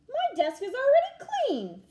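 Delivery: put-on high, loud boast of a proud boy; proudly. A narrator's reading. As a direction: show off that clean desk. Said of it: "Philip said proudly".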